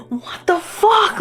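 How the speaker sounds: high, slow incredulous mock outburst; a streamer mock-fuming at the screen; mock outrage. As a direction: drag it out in disbelief. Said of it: "I'm like".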